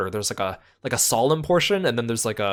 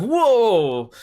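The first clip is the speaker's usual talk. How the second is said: drawn-out, higher-pitched awed whoa; crowd's whoa; 8 semitones higher, 4 dB louder than usual. Retold or imitated. Crowd watching him fall off the stage, amazed. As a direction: mimic a crowd reacting in amazement. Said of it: "everyone's like".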